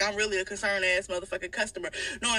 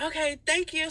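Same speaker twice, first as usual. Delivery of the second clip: higher, sweet fake thanks; fake polite; 7 semitones higher than usual. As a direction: taunt him with politeness. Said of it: "So I'm like".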